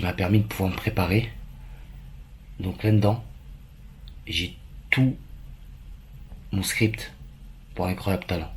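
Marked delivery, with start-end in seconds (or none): none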